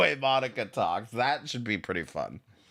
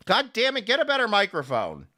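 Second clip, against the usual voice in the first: higher, heated demand; raised voice, fed up; exasperated outburst; 8 semitones higher, 4 dB louder than usual. Real, not acted. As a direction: snap in real exasperation at bad audio. Exasperated at caller's bad audio.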